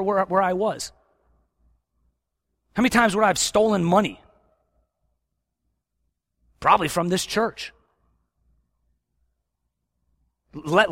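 loud, high, driving sermon voice; fiery preaching. Acted. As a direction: preach it hard to the congregation. Preaching at full force.

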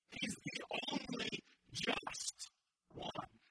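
loud sermon declaration; forceful preaching. Acted. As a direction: drive the point home to the church.

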